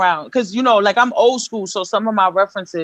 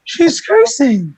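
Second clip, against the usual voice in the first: high, slow, mock horrified; drawn-out pearl-clutching tattle; mock scandalized fan; 10 semitones higher, much slower than usual. Imitating fans who judge her cursing, mock horrified. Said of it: "those people like".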